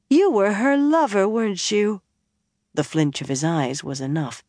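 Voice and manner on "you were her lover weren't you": put-on higher, louder accusing girl; tipsy accusation. A narrator's reading. Elaine accusing the bard of being her mother's lover, loud and tipsy. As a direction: throw the charge at him.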